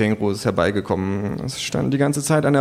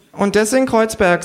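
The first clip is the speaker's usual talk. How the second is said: high, dry, mocking kangaroo; 11 semitones higher, 4 dB louder than usual. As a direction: needle her as the kangaroo. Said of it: "sagt das Känguru". (hohe, trocken spöttische Känguru-Stimme)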